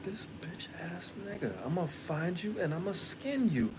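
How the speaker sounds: unvoiced, hushed threat under the breath; menacing whisper. Muttering a threat at the doctor in a whisper. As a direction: whisper the threat so only you hear it. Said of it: "And I was like".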